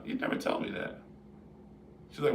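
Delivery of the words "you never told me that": surprised husband. Retold or imitated. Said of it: "I'm like".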